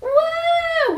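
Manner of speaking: very high, loud whoop; mock cheering. Disciples cheering Jesus at his miracle, shrieking whoop. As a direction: cheer like a fan at a victory. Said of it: "and we're all like".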